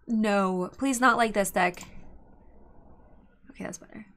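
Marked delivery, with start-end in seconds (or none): 3.5-4.0 whisper. Real, not acted. Relief after opponent's deck shown.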